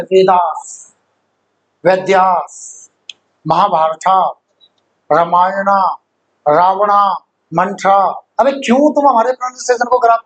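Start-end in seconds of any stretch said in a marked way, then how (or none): 0.0-8.2 Hindi names in a lightly mocking English accent, with an added final a; lower-pitched English accent imitation that stretches the names. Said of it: "अब अंग्रेज क्या बोलते हैं?". (अंग्रेज़ी लहजे की हल्की नकल)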